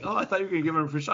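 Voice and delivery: put-on quick, high, light girl voice; girl's voice. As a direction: mimic the girl lightly, fast. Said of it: "the girl's like".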